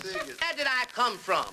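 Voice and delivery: put-on high, slow small-boy question; little boy voice. Little boy asking his father, child voice. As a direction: ask it high and drawn out. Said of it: "about the little boy that walked up to his father".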